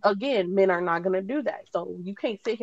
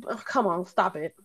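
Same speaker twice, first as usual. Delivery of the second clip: ordinary delivery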